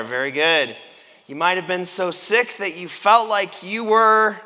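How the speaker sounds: ordinary delivery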